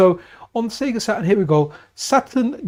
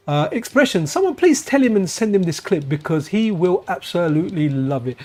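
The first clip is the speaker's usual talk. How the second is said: usual speaking voice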